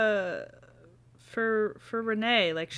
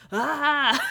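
high, loud mock scream of anxiety; exasperated little shriek; anxious scream; 7 semitones higher, 5 dB louder than usual. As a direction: let out a pent-up "ah". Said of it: "And it's just like".

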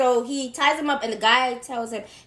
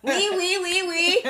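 higher, sing-song mock French; French accent; 5 semitones higher than usual. Co-host teasing about the accent, high mock French. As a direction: tease with a cartoon French accent. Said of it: "to do the French accent".

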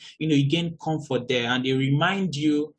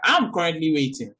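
ordinary talk

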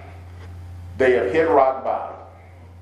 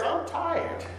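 breathy, much softer weary line; weary sigh; 12 semitones higher, 9 dB quieter than usual. Acted. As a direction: let it fall away. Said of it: "he finally says".

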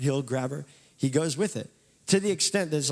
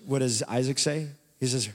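normal talking voice

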